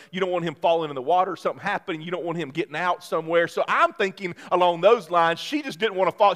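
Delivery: normal talking voice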